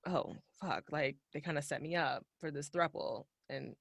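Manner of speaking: hushed, thinking aloud to herself; quiet inner musing. Retold or imitated. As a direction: mutter it under your breath, unsure. Character musing over a setup.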